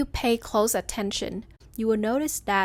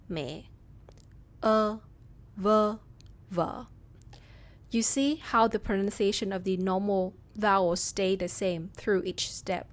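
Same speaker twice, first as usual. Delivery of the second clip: usual speaking voice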